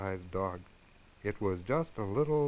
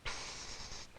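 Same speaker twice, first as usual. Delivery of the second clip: whisper; 12 dB quieter than usual. A narrator's reading. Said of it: "said as loud as he could".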